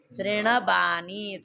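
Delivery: शृणवाणी drawn out slowly, tongue curled back on both ण; careful retroflex शृणवाणी. Shown as a demonstration. Teacher asks students for attention, slow careful शृणवाणी. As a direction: stretch each syllable of शृणवाणी. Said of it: "थोड़ा ध्यान रखना पड़ेगा".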